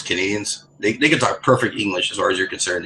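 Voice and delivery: normal talking voice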